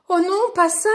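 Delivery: high, loud cry of dismay; alarmed yell. A narrator's reading. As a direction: yell in storybook panic. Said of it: "hurla Lou".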